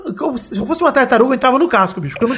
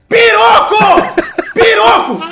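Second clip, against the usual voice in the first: repeated yell, much higher and louder; 11 semitones higher, 5 dB louder, much slower than usual. Retold or imitated. Ricardo yelling his surname again because she didn't hear, screaming. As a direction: scream the name twice at full force. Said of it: "ele começou a gritar".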